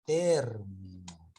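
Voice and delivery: "Término" slow, TÉR loud and drawn out; slow "Término", falling away after TÉR; stress on TÉR. Shown as a demonstration. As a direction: punch and stretch the first syllable of "Término". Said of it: "sería, sería con tilde".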